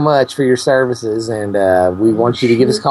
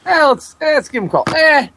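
squeaky high goofy character; high cartoon voice; faster than usual. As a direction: pitch way up like a cartoon. Put-on character voice.

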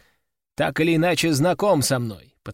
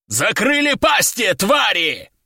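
furious roaring shout; 5 semitones higher, 3 dB louder, slower than usual. A narrator's reading. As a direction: bellow it to shake the walls. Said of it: "От моего рыка".

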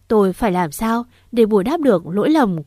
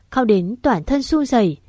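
ordinary delivery

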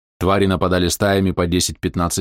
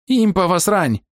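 panicked shout; 11 semitones higher, slower than usual. Acted. Panicked companion Maus.

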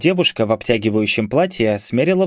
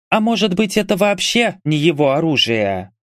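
higher-pitched, mildly peeved voice; displeased grumbling; 6 semitones higher than usual. A narrator's reading. Mage girl doubting the weapon is his, displeased. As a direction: grumble a doubt, starting high. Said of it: "недовольно проворчала Магесса".

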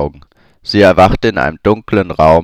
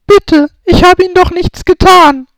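put-on much higher, louder young woman, begging; Hermine pleading; 7 dB louder than usual. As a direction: plead for mercy. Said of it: "flehte Hermine".

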